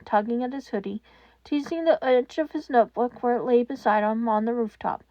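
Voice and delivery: ordinary delivery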